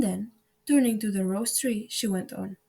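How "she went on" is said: usual speaking voice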